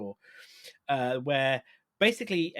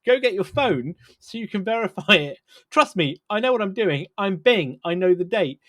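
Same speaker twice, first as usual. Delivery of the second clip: louder, a hammed-up know-it-all chatbot; mock smug insistence; 3 semitones higher, 7 dB louder than usual. Host voicing Bing lecturing the user, loud mock smugness. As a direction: talk down to the user like a pompous machine, in jest.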